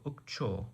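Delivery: "Ukcho" said softly with cho stressed; final-syllable stress. Shown as a demonstration. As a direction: show "Ukcho" softly, accent falling on the last syllable. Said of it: "With a stress on the final syllable".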